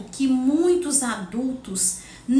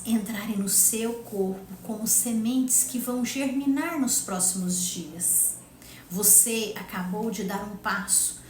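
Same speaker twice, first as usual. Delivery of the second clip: sleep story narrator; 3 dB quieter than usual. Acted. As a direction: ease them back gently after breathing. Continues guided breathing. (voz calma de meditação guiada)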